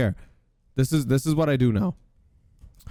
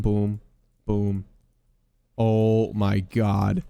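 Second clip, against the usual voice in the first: ordinary talk, nothing marked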